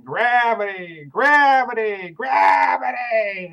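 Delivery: loud, drawn-out street hawker's yell; imitated yelling. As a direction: holler it long and high, falling away each time. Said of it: "he was yelling".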